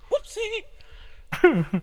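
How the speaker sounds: high squeaky voice; squeaky whoopsie. Pretend. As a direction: pitch it way up, cartoonish. Silly button sound.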